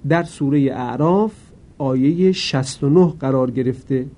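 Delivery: usual speaking voice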